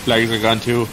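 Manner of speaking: deep growly wolf voice, flat and resigned; a werewolf grumbling that the flags are gone; low werewolf voice. Acted. Werewolf sketch, hurling pitch.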